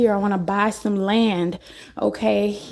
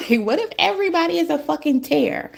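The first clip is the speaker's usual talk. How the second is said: high, fast words bubbling with a laugh; laughing through the scary thought; laughing disbelief; 7 semitones higher, faster than usual. Real, not acted. To her viewers, half laughing at the scary idea.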